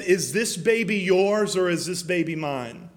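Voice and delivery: ordinary talk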